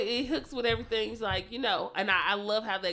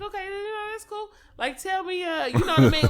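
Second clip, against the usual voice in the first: squeaky, much higher voice; high mock voice; 10 semitones higher than usual. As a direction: act all sweet and agreeable, pitched way up. Her own reply, acted.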